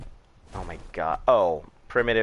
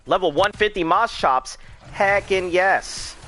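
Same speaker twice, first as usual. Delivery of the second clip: excited; 9 semitones higher, 4 dB louder than usual. Real, not acted. Excited about a rare dino find.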